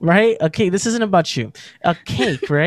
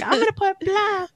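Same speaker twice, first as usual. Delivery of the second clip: imitating Karina; 13 semitones higher, 4 dB quieter than usual. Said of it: "she was like".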